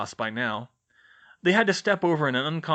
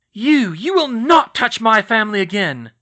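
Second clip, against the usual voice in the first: fierce hiss; 9 semitones higher, 11 dB louder than usual. Acted. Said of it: "Missus Weasley hissed".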